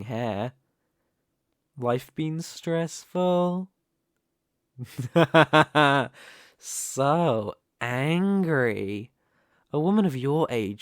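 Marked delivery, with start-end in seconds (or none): 4.8-6.1 loud staccato villain laugh; gloating burst of laughter; mocking villain laugh. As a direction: laugh in their faces. Villain mocks the cornered guests.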